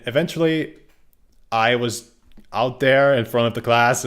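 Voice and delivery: ordinary talk, nothing marked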